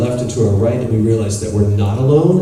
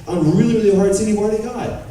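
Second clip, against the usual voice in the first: retold exasperated rant; 11 semitones higher than usual. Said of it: "And I was like".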